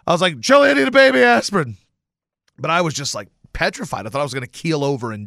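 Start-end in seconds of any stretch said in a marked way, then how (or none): 0.4-1.8 high, loud panicked plea, like his dad; a man sure he is having a heart attack, calling out loud and high for aspirin; imitating his dad. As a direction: play his dad begging for a baby aspirin, higher and louder. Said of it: "I felt like my dad".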